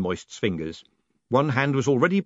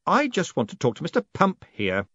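slightly raised, curt dismissal; sharp addition; 7 semitones higher than usual. A narrator's reading. Moist sending the others away, sharply. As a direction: add it pointedly. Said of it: "added sharply".

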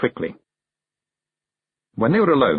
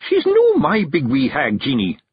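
higher, emphatic Scots objection; Rob, Scots clan chief; 7 semitones higher, 3 dB louder than usual. A narrator's reading. Rob objects to his wife about the hag, emphatic. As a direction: correct her firmly in broad Scots.

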